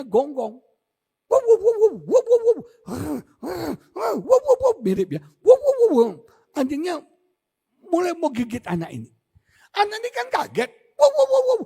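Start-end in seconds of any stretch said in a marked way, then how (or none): 1.2-6.1 loud high barking imitation; loud yapping dog noises. 11.0-11.6 high loud yapping bark; dog barking. Retold or imitated. Startled dog bark again.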